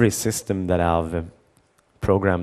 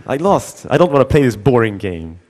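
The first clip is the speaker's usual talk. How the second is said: put-on higher, louder whiny kid complaint; sulky kid; 4 semitones higher, 6 dB louder than usual. Son sulking about the boring game, whiny kid voice. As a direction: whine like a sore loser kid.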